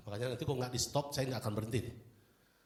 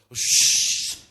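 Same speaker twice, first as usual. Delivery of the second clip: angel hush; 12 dB louder than usual. Retold or imitated. Angel's sound.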